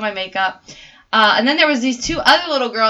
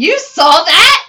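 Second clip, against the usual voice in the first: drawn-out, louder disbelief; slow amazed question; 6 semitones higher, 8 dB louder, much slower than usual. Retold or imitated. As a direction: stretch it out in disbelief. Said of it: "He goes".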